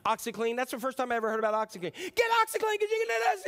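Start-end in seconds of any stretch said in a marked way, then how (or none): 1.9-3.4 much higher and louder, a bellowed product pitch; Billy Mays yell. Retold or imitated. TV pitchman yelling at viewers about the cleaner, hammed-up shout. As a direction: shout it like an infomercial host. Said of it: "they're just yelling".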